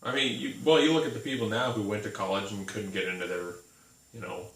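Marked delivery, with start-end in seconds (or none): none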